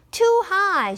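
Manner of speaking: put-on high, fussy girl voice; girl complaining. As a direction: reject it like a picky child. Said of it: "she said".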